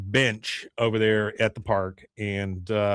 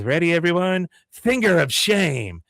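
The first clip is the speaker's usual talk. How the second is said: Luann voice; 6 semitones higher, 3 dB louder than usual. Retold or imitated. Luann leading protest.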